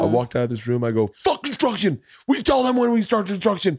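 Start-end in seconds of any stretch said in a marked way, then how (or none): 1.1-3.8 loud, very high frantic shouting; hammed-up angry rant. Dad declaring construction is off for daughter, angry high shouting. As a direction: explode into a screaming tirade. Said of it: "I went immediately ... angry".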